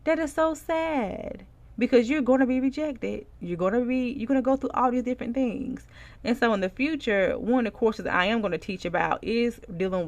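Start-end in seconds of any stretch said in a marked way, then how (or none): 0.0-4.1 mock pity. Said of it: "Or I'm just like".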